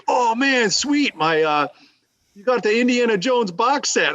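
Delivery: lightly voiced buddy, pitched high and eager; a hint of a gushing friend; excited buddy. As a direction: imitate a friend thrilled by the box set. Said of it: "he's like".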